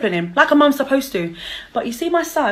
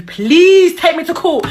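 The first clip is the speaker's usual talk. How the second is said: slow, loud, higher dare; 6 semitones higher, 5 dB louder, slower than usual. Real, not acted. Daring her ex to sue her, slow and loud. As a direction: drag it out as a dare.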